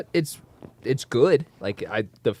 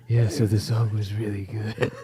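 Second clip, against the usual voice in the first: vigilante growl; 2 semitones lower, 4 dB louder than usual. Retold or imitated. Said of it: "trying to sound like a vigilante".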